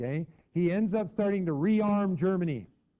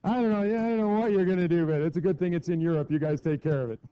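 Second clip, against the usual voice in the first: higher, louder, fast laughing brush-off; dismissive Americans; 3 semitones higher, 5 dB louder than usual. Retold or imitated. Americans shrugging off Europe's problem, laughing brush-off. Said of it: "Even the Americans were going".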